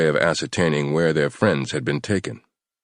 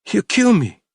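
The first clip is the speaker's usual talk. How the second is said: high, frightened whimper; a scared captive begging under a gun; 5 dB louder than usual. A narrator's reading. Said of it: "he whimpered".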